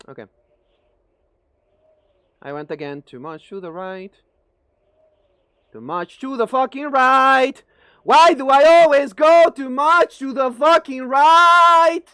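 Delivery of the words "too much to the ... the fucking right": louder and slower, building into a yell; exasperated gamer raging at the screen; frustrated yell. Real, not acted. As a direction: yell at yourself for the same mistake. Frustrated after missing the jump again.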